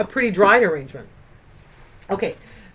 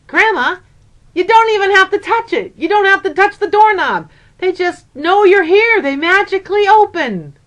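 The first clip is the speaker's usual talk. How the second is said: put-on higher and louder, a little boy eagerly explaining; excited child explaining; 9 semitones higher, 6 dB louder than usual. Grandson eagerly tells grandma how the doors open by magic, excited child voice. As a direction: pitch it up like an eager four-year-old.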